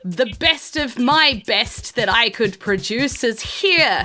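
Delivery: high, loud, slow announcing; proud host. Acted. Host presents his family's champion, grandly. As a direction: boast to the crowd. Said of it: "And he's like".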